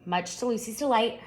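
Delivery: usual speaking voice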